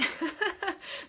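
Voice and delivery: bright rising giggle at herself; high giggle. Real, not acted. Laughing at her own lighting struggle, high giggle.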